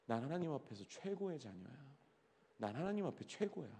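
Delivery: soft, sincere affirmation; soft self-affirmation. Shown as a demonstration. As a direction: say it quietly to yourself, believing it. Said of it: "스스로에게 얘기하세요".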